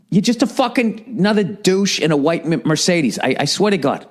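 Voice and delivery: usual speaking voice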